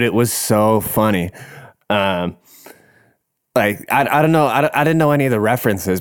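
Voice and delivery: usual speaking voice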